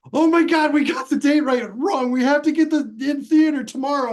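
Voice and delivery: high, frantic retelling of a panicked call; mock panic, way up high; panicked friend on phone. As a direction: squeal it out like the sky is falling. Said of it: "and he says".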